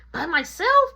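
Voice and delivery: put-on high, loud child's protest; loud mock-alarmed child; alarmed kid. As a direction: squeak it out like a kid in disbelief. Kid alarmed at walking alone.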